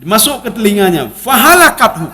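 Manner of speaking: ordinary talk, nothing marked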